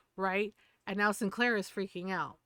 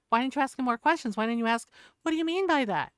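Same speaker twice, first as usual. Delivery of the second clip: louder and higher, firing off questions fast; heated rant; 5 semitones higher, 6 dB louder, faster than usual. Real, not acted. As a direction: demand answers, building in anger. Ranting at voters who regret their vote.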